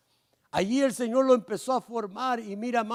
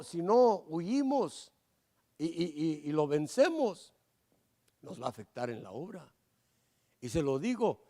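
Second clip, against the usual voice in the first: ordinary talk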